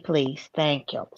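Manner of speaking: ordinary delivery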